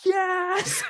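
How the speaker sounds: very high, loud exultant cry; triumphant yes. Retold or imitated. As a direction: cheer it like a victory. Teacher celebrating the breakthrough.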